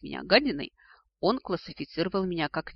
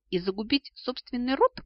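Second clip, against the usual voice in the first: higher-pitched, impudent question; cheeky; 7 semitones higher, 4 dB louder than usual. A narrator's reading. Descendant challenging the dragon who threatens her, cheeky. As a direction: ask it with brazen nerve. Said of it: "нагло осведомился благодарный потомок".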